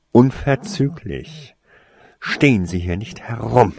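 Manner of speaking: measured orders, building in force; controlled authority, firmer at the end; icy command. A narrator's reading. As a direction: dispense cold orders one by one. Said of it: "befahl Snape voll eisiger Kälte".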